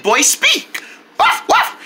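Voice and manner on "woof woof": high, short puppy barks; two quick high woofs; dog barking. Acted. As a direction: yap like a little dog. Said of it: "He spoke".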